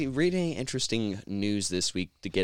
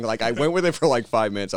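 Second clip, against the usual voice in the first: ordinary talk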